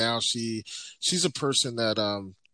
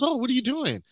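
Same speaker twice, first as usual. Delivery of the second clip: much higher, puzzled wife voice; imitating wife; 12 semitones higher, 3 dB louder, faster than usual. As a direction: mimic the wife's curious question, pitched way up. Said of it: "she goes".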